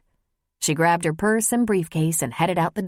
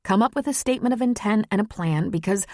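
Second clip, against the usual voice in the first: woman confessing love, voice pitched higher; vulnerable, insistent Maggie; 4 semitones higher than usual. Acted. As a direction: plead and demand an answer from your lover.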